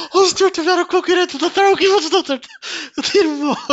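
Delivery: high, loud words through laughter; barely getting it out for laughing. Real, not acted. Vlogger cracking up at the article, laughing.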